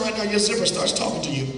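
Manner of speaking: fast, steady hypnotist patter; hypnotist suggestion. Acted. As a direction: talk them into the trance, rushing.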